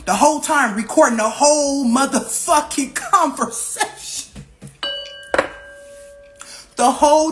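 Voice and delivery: rising outrage at a fake friend; outraged. Real, not acted. Outraged at betrayal recording.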